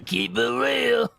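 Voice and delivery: slow hammed-up goblin New Yorker; bad New York accent. Streamer mimicking the goblin's bad New York accent. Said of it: "goblins should sound like bad New Yorkers".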